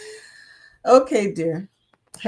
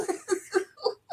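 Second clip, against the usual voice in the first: high laugh; 12 semitones higher, 8 dB quieter than usual. Real, not acted. Laughing at internet.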